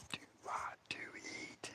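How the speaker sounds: barely audible, slow whisper; mouthed whisper. Retold or imitated. Dave secretly tells his friend across the fire, mouthed whisper. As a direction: mouth it with almost no sound. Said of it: "He just mouths it to me".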